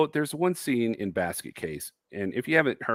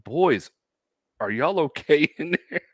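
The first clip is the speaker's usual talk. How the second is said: higher-voiced dad check-in, breaking into laughter; concerned dad; 6 semitones higher, 3 dB louder than usual. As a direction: imitate a dad calling in, pitched up. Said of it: "his dad comes in and goes".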